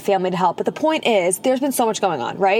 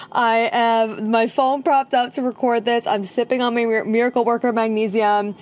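normal talking voice

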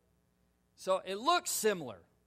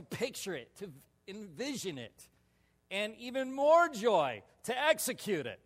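excited; 5 semitones higher, 9 dB louder than usual. Real, not acted. Excited recollection of the fight.

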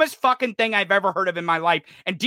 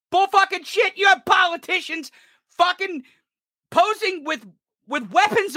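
bull fucking shit, much higher and furious; angry outburst; 8 semitones higher than usual. Real, not acted. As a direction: explode on it in real anger. Ranting, repeats the phrase higher.

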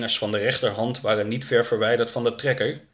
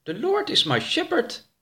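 high-pitched character reading out foreign words; high read-aloud with a lift. Acted. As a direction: read it out in a high voice, unsure of the English. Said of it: "hardop voor".

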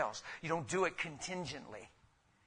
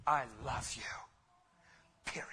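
hushed whisper, "I love you" said softly; 3 semitones lower, 4 dB quieter than usual. Acted. Pastor voicing Christ's love to everyone, whispered. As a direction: whisper it like a secret promise.

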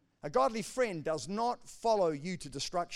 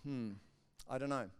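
doubtful hmm; 7 semitones lower, 8 dB quieter than usual. Retold or imitated. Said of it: "your wife felt".

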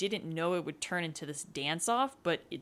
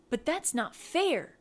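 pouting protest; 8 semitones higher, 3 dB louder than usual. A narrator's reading.

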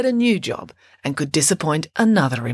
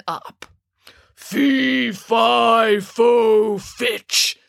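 loud, drawn-out giant chant; booming giant; 2 semitones higher, 3 dB louder, much slower than usual. A narrator's reading. Giant sniffing out his dinner, booming giant voice. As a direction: chant it big, like a hungry giant. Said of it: "said the giant".

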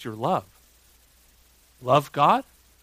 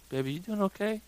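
soft, higher-pitched gentle check-in; hushed concern; 6 semitones higher, 9 dB quieter, faster than usual. Retold or imitated. Dad checking on his whimpering daughter in bed, hushed concern. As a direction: ask softly, as to a hurt child at night. Father soothing whimpering child at night.

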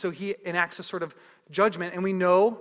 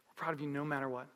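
low, soft, quicker delivery; tender reassurance; 5 semitones lower, 10 dB quieter, faster than usual. Retold or imitated. Parent to child about unconditional pride, soft tender reassurance. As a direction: reassure softly, voice dropped low.

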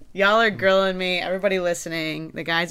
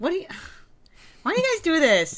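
much higher and louder, fed up; exasperated outburst; 11 dB louder than usual. Real, not acted. Host exasperated that the guys won't share, high loud whine. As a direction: whine it, pleading and frustrated.

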